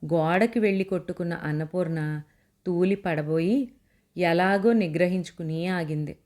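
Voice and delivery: plain reading voice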